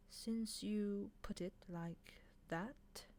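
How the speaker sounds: slow, reluctant, under the breath; the angel giving in, slowly and grudgingly. Acted. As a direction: drag the words out grudgingly. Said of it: "said the angel slowly".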